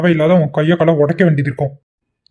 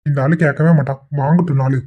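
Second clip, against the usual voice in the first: ordinary talk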